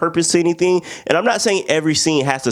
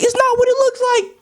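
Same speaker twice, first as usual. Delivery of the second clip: very high squeaky plea; high hammed-up girlfriend denial; mock pleading girlfriend. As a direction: squeak out an excuse, caught red-handed. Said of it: "she's like".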